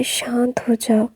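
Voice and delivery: "शांत हो जाओ" whispered soft and slow to calm him; soothing whisper. Acted. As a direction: whisper it close, like settling a frightened boy. Comforting scared Wing, whisper. (डरे हुए wing को शांत करते हुए धीमी फुसफुसाहट)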